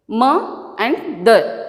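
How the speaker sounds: "Ma" and "the" as separate, drawn-out beats; split into syllables. Shown as a demonstration. Teacher counting parts for learners, "Ma and the" slowly split. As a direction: slowly set "Ma" apart from "the". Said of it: "I have split this word".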